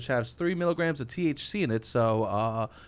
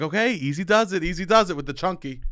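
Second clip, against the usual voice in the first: slightly raised coaxing dad voice; calming dad; 6 semitones higher, 5 dB louder than usual. Retold or imitated. Dad coaching his kid driver, mildly raised and soothing. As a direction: ease the kid down, a touch louder. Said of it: "He's like".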